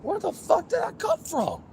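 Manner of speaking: very high-pitched bewildered exclamation; real alarmed disbelief. Real, not acted. Baffled by source of noise.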